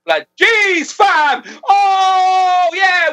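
high, loud mock-amazed outburst; exaggerated exclamation. Teasing a friend over his celebrity pals, exaggerated high exclamation. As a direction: cry out in hammed-up disbelief.

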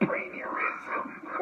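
slow breathy whisper through a laugh; whispered laughing repeat. Shown as a demonstration. Repeating his made-up word to a friend, slow laughing whisper. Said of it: "I called it a brainurism".

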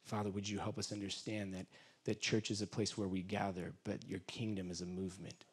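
soft, low, intimate praying; quiet prayer. Acted. Pastor praying quietly over the congregation, soft and low. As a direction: pray softly, almost to yourself.

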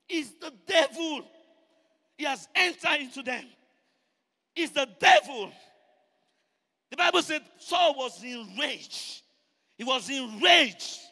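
loud, emphatic preacher shout; full-force preaching. Real, not acted. Preacher tells congregation of the devil in Saul, full-force preaching. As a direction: shout each statement home to the congregation.

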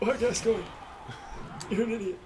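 high, squeaky mocking taunt; funny high voice. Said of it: "I love that voice so much".

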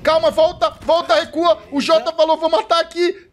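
high, loud, rapid play-by-play; shouting for the player to fall back; urgent excited calling. Real, not acted. Streamer calling a clutch live, high, loud and urgent. Tense play-by-play, urging retreat.

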